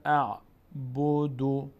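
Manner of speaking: اعبدو recited very slowly; slow recitation. Shown as a demonstration. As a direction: chant اعبدو drawn out. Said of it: "این دال آخر در هنگام توقف".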